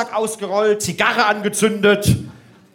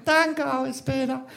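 higher, much softer put-on voice; frail models; 4 semitones higher, 8 dB quieter than usual. Two skinny models thanking the instructor, soft and high. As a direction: imitate them in a small, weak voice. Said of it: "Kamen zwei magersüchtige Models".

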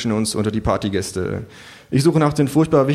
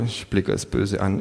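usual speaking voice

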